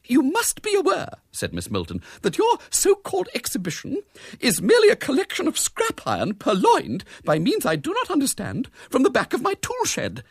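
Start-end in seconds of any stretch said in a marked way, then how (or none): 0.0-1.1 very high, offended spinster; high-pitched outrage; prim indignation. A narrator's reading. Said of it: "quivered with indignation". 2.2-10.2 very high, offended spinster; high-pitched outrage; prim indignation. A narrator's reading. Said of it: "quivered with indignation".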